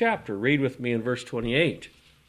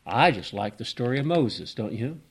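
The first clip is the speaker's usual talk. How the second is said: usual speaking voice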